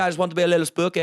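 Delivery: high, loud, put-on vampire voice; hammed-up shy vampire asking to go on; [name]'s vampire voice. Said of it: "he plays a vampire in our shows".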